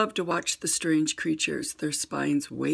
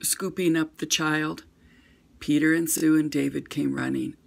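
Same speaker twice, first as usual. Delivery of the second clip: ordinary delivery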